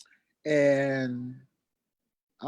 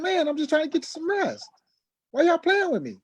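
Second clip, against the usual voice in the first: exasperated. Retold or imitated. Pleading with his family to let him rest, frustrated. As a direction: plead to be left alone, irritated. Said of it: "I'm saying".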